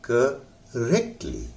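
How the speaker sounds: Correctly slow, रेक strong, ली light; stress on rek. Shown as a demonstration. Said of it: "रेक पर जोर लगाना है". (रेक पर जोर देकर करेक्टली)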